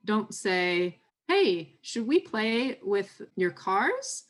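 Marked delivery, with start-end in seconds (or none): 1.3-4.2 higher, slow, sing-song question to a little one; raised, drawn-out child-directed question; parent coaxing child. Shown as a demonstration. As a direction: invite a young child to play, pitched up and unhurried.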